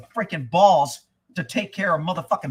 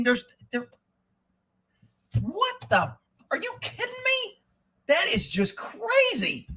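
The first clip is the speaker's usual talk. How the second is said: high-pitched, worked-up incredulity; stunned disbelief; 7 semitones higher than usual. Real, not acted. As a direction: pitch up high in shock at what you read. Worked up reading the article.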